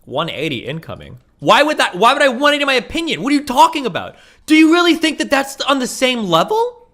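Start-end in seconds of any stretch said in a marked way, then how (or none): 1.4-6.9 high, loud, indignant questions; real exasperated protest; incredulous outburst. Real, not acted. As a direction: push back in raised-voice disbelief. Incredulous at suggestion of changing opinion.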